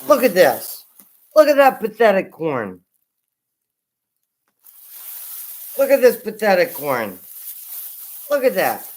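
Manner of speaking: loud so the mic still hears; loud to carry. Real, not acted. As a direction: project it while walking off. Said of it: "I'm just being extremely loud because I'm walking away".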